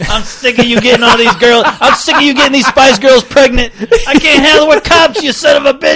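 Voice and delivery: crotchety rant building to a high mock shout; Nick Nolte. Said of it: "like just mad as hell".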